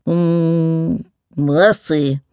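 mumbling creature, mildly astonished; a drawn-out hum of mild astonishment; astonished mumble. A narrator's reading. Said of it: "the liquid voice mumbled in abrupt astonishment".

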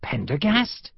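stunned, questioning Pendergast; astonished recognition. Acted. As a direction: say Pendergast in disbelief. Sergeant suddenly recognizes old friend.

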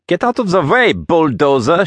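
put-on high-pitched accented shout. A narrator's reading. Blue-haired woman orders the giant out of the way, high shout. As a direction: yell it high at a big oaf. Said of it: "shouted the blue-haired woman".

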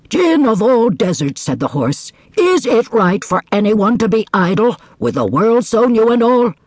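high, indignant horse; tattling horse. Acted. Horse complains to djinn.

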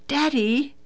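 high-pitched, delighted little call; excited child. A narrator's reading. Daughter running to hug her father, excited.

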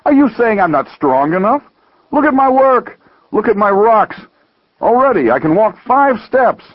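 high, affronted protest; incredulous, worked-up boasting. Acted. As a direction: insist on your strength, pitched high and indignant. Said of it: "asked incredulously".

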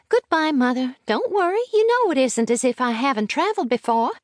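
put-on high child voice, reassuring her mother; bright-eyed child's farewell, pitched well up; self-assured little girl. A narrator's reading. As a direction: play a small girl who feels well travelled.

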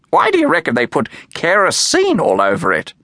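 high squawky macaw voice; parrot voice. A narrator's reading. Doris the macaw asks.

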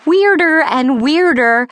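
plain reading voice

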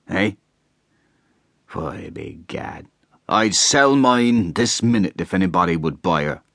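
slow, loud boozy countryman holding forth; a drunk husband mocking his wife; lightly voiced tipsy rustic; tipsy hay trusser. A narrator's reading. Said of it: "the hay trusser announced".

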